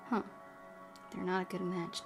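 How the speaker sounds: ordinary talk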